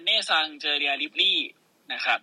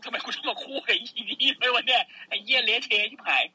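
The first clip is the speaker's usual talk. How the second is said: mock exasperated complaint; 6 semitones higher than usual. Nox complaining about partner.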